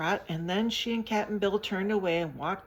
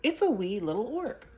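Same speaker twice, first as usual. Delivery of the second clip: higher, louder surprised exclaim; light astonishment at a tiny orc; a touch of delighted surprise; 7 semitones higher, 7 dB louder than usual. A narrator's reading. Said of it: "said in surprise".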